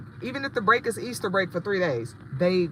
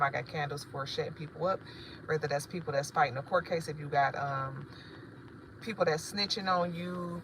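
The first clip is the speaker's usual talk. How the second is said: normal talking voice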